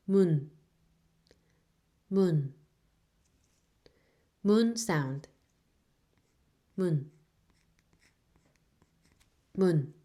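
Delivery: teacher models "mun" for learners; Korean syllable mun. Shown as a demonstration. Teacher repeating "mun" clearly for students. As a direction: say "mun" slowly and clearly, held, as a model sound. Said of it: "this one sounds like".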